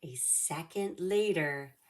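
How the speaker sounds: lively read-aloud storybook voice; reading to children. A narrator's reading. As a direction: read it like storytime for little kids. Reads picture book to kids.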